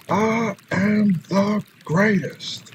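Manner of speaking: very slow, drawn-out champion's boast; Muhammad Ali. Retold or imitated. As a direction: stretch every word like a champ at the mic. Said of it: "Muhammad Ali is one of my".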